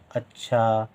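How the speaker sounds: ordinary delivery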